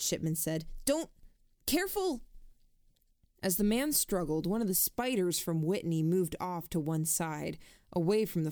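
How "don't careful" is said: sudden high urgent plea; urgent warning. Acted. Shipman warning the struggling man not to tear it, urgent high plea. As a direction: stop him before he tears it, urgently.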